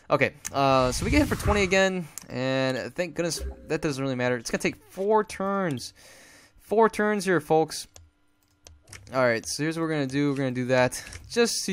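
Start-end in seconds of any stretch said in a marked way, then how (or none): none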